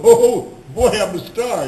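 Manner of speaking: put-on high, loud "oh boy" easing off; hammed-up starstruck excitement; mock elation. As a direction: crow with pretend glee. Mimicking an over-excited new star.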